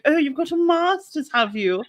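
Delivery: high teasing mimic of "master's"; mocking friends. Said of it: "they'd be like".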